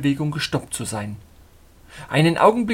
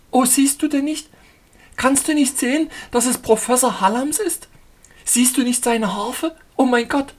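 much higher, louder cries of horror; horrified heroine; 10 semitones higher, 5 dB louder than usual. Acted. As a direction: wail it as the dead fiancé is found. Heroine sees fiancé's crash.

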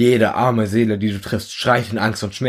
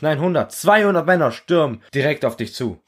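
plain reading voice

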